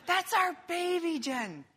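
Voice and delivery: tearful joyful exclaim; emotional cry. Retold or imitated. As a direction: relive the overwhelmed new dad. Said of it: "I started bawling".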